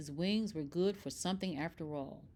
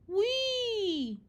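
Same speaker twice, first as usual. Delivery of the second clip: joyful squeal; 12 semitones higher than usual. Acted. Flying happily with friends.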